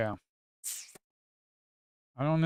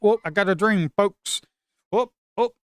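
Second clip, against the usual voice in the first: excited whoops; 9 semitones higher, 10 dB louder than usual. Real, not acted. Cheering a chart breaking out.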